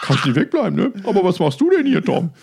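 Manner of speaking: high loud silly voice, half laughing; mock Gunnar voice. Gunnar answering Tom at the festival, squeaky goofy voice. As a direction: play him squeaky and goofy.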